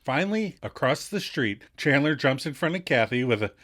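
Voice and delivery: ordinary talk, nothing marked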